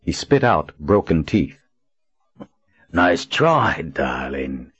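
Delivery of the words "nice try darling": rough villain voice. A narrator's reading. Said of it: "Marlin said in a rough voice".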